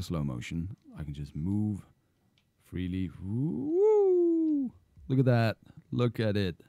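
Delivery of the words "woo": long, high-pitched gliding "woo"; playful high whoosh noise; drawn-out woo. Retold or imitated. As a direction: swoop up and hold it. Mimics movement in slow motion.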